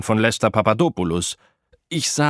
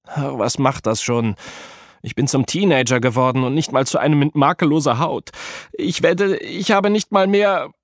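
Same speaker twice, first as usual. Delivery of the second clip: whiny despair; 5 semitones higher than usual. A narrator's reading. Apollo ranting to Mac about his skin, whiny. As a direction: complain like a spoiled teen god.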